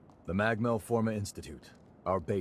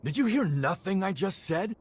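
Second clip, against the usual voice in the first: exasperated; 10 semitones higher, 4 dB louder than usual. Acted. Noah annoyed at Valentin stalling.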